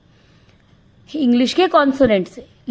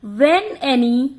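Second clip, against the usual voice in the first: slow dictation; 3 dB quieter, much slower than usual. Shown as a demonstration. Teacher dictating a grammar rule to the class, slowly. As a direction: read the rule out slowly, word by word, so students can write it.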